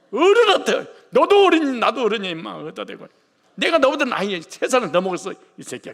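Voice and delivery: high, loud bickering shout; hammed-up quarrel between husbands; a husband yelling at a man his own age, mock rage; mock angry husband. Voicing husbands bickering angrily.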